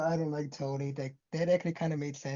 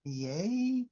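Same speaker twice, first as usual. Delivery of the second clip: YAY drawn out with a questioning rise; doubtful question; 3 semitones higher than usual. Real, not acted. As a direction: say YAY as if unsure it is good news. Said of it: "Question mark?".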